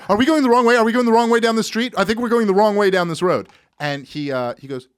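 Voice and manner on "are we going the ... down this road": high, loud panicked questions. Retold or imitated. Scared passenger asking the Uber driver, high loud panic. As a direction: blurt it out in rising panic. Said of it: "so I'm freaking out".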